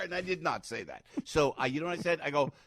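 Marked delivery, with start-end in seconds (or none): none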